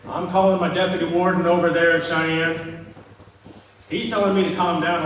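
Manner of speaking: ordinary talk, nothing marked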